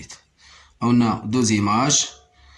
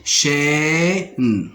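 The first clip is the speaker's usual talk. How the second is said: "Chêne" with a long, higher chê, then a separate ne; split into syllables; 4 semitones higher than usual. Shown as a demonstration. Teacher locating the sound for learners, "Chêne" split with chê held. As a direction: lean on and stretch the first syllable of "Chêne". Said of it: "se trouve dans la première syllabe".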